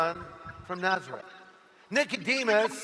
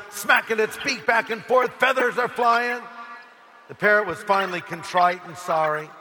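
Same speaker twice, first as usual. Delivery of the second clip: ordinary talk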